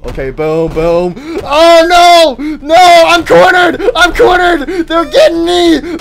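high, loud, crying panic; panicked cry. Real, not acted. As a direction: wail as enemies close in. Cornered by enemies.

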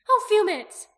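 high, loud cry of dismay; alarmed cry. A narrator's reading. Aki crying out as the dragon trembles, high alarmed cry. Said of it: "Aki cried".

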